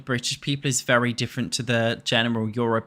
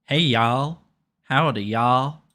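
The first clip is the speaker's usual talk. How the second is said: put-on Southern accent. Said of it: "How good's my Southern accent?".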